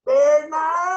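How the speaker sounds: high, loud call of Bernardo across the farm; countryside shout. Pretend. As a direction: yell for the kid out in the roça. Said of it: "a gente vai lá na roça".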